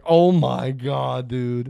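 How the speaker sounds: mock-stunned slow oh my God; stunned disbelief. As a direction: stretch it out slowly, appalled. Reacting to cruel movie line.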